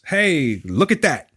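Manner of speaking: a hint of a cheery tour guide; lightly voiced gushing tour guide. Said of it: "all I really saw with that was".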